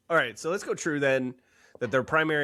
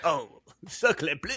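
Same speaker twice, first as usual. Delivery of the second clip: mock French; 4 semitones higher, slower than usual.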